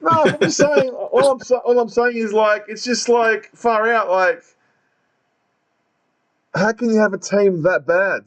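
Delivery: high-pitched, worked-up defense; insist, voice jumping up high; excited protest. Real, not acted. Defending his own remark.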